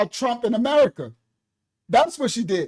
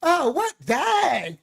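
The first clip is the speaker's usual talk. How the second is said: higher, drawn-out oh yeah of realisation; sudden realisation; 3 semitones higher, much slower than usual. Retold or imitated. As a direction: slowly realise you got played. Realising the scam.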